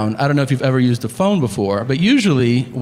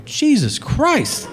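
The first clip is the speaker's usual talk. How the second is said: drawn-out slow, higher "Jesus Christ"; stunned disbelief; 10 semitones higher, much slower than usual. Retold or imitated. Stunned by the father.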